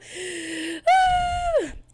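high, loud, wailing cry of exasperation; anguished wail. Real, not acted. Nervous host breaking down mid-intro, loud anguished wail.

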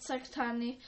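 ordinary delivery

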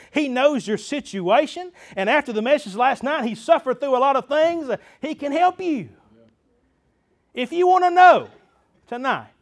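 high, loud preaching build; fervent preaching. Real, not acted. Preacher urging church that He can help, fervent preaching. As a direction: push the sermon up to a ringing call.

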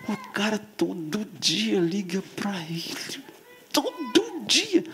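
whispered, then high and slow; leaning in to whisper. Acted. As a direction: hush it, then stretch it out. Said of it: "vou falar baixinho pra vocês".

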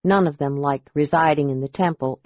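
plain reading voice

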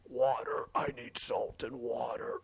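slow raspy whisper; alien whisper. Retold or imitated. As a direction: gasp it out like a dying alien. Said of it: "He's like".

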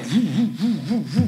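a playful, lightly voiced phone-vibration noise; imitated phone buzz. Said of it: "meu celular começa".

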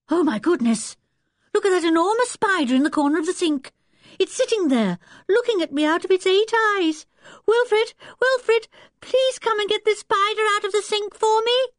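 high, rising alarm; alarmed cry for help. A narrator's reading. Anne calling Wilfred to remove the spider, alarmed and high. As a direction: call out in mounting panic, pitch climbing fast.

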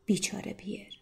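unvoiced whispered pity. A narrator's reading. Narrator whispers pity for poor Pierre. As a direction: breathe it like a sigh for him.

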